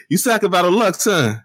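high-pitched kid-like mocking voice. Podcaster mocking a battler on camera with [name], kid-like voice. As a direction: mimic him like a pestering little kid. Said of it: "Why you making him sound like a kid".

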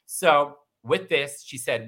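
normal talking voice

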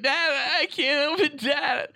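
put-on high, tearful kid wailing for dad; crying child. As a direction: wail like a panicked young boy.

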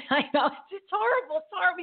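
high-pitched dismay; squeaky exasperated wail. Real, not acted. Exaggerated dismay.

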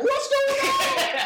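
very high, drawn-out mock cry; Jacob. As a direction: stretch it out in a high-pitched, startled yelp. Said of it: "Jacob was popping out of the pool like".

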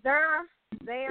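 "there" tried out as "thurr", questioning; "there" with an "ur" vowel, as a question; St. Louis pronunciation. Shown as a demonstration. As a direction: say "there" to rhyme with "her", checking it. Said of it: "Am I saying it right?".

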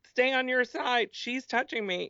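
put-on high whiny kid tattling to mom; whiny tattling little sibling. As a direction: whine like a kid in the back seat. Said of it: "one of my thighs is like".

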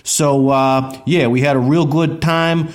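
loud, upbeat host intro voice; podcast intro. Acted. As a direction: announce it like a show host.

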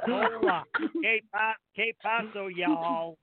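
Spanish greeting in a twangy Missouri drawl; mock Mexican Missouri accent. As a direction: play a Mexican from Missouri, hamming it up. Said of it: "they talk a little something like this".

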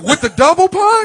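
high disbelieving squeal. Real, not acted. Laughing disbelief at the punch.

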